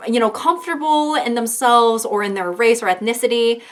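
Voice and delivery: usual speaking voice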